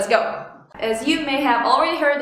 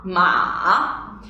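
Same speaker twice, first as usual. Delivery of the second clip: low dipping "ma"; "ma" falls, then rises; third tone; 6 semitones lower than usual. Shown as a demonstration. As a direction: drop "ma" deep, then curve it up. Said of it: "Once again".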